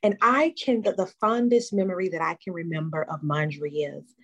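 ordinary talk, nothing marked

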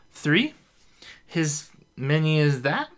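high, slow slurred puzzlement; drunk confusion. A narrator's reading. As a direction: count slowly, lost and tipsy. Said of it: "tries to count her fingers".

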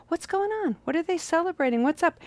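ask, baffled by the party; puzzled abbot. Retold or imitated. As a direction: abbot asking his advisor, surprised.

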